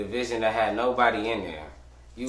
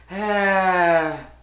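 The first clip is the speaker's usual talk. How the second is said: loud sigh; 8 semitones higher, 7 dB louder than usual. Real, not acted. Exasperated reaction.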